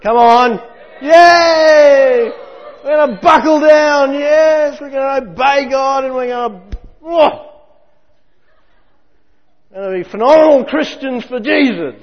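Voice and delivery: high, loud hyped-up cheering; hammed-up shouting of eager believers; mock cheerleading. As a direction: whip up the crowd like a pep rally. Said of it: "All of that".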